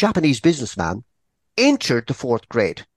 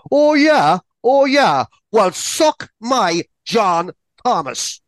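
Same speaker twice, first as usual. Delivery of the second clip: high, loud acted outburst, slowing into the taunt; yelling student; 9 semitones higher, 4 dB louder, slower than usual. A narrator's reading. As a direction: yell it like a furious pupil, drawing out the end. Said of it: "yells and screams from the top of his voice".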